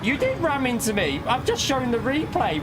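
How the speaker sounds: normal talking voice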